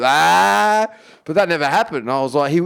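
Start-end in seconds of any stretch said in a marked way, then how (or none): 0.0-0.9 sudden high, loud mock yell; imitated angry yell. Imitating the angry coworker he expected: a sudden loud yell. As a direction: bark it out like a guy who just lost his temper. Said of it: "I'm waiting for him to go".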